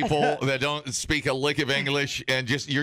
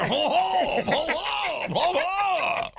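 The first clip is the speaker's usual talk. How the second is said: put-on high, whooping drunk-tourist hollering; drunk idiot tourists; much slower than usual. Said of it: "a group of those drunk idiots".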